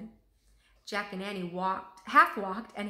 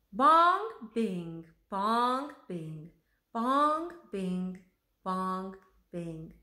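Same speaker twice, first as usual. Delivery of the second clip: sing-song bongs and bings going up and down; playful imitation of chiming church bells; bell sounds; 3 dB louder, much slower than usual. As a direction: ring out like cathedral bells.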